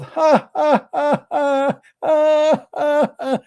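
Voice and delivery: high mocking devil cackle; Shaitan laughing. Shaitan laughing inside a yawner's mouth, high cackle. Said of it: "he will start laughing".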